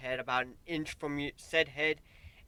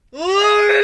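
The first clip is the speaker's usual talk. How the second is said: much higher, loud yell of a name; furious shout; 15 dB louder than usual. Acted. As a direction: bellow her name in rage. Narrator yells at the princess.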